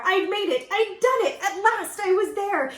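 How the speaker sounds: high, loud triumphant cry. A narrator's reading. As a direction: cheer your arrival. Arriving at last.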